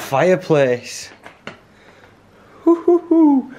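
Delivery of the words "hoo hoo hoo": delighted hoots. Real, not acted. Delighted at the bothy fireplace.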